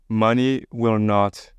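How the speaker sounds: usual speaking voice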